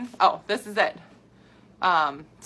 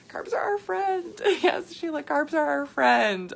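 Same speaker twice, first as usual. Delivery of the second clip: upbeat slogan; 8 semitones higher than usual. Real, not acted. Cheerful slogan to viewer.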